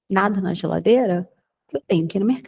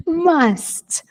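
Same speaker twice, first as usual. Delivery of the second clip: Must jumps up high, squeaky; playful high squeak on Must; high mock must; 8 semitones higher than usual. Shown as a demonstration.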